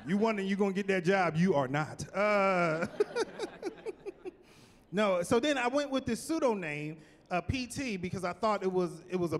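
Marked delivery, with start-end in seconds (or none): 2.9-4.3 high giggle at his own joke; laugh. Real, not acted.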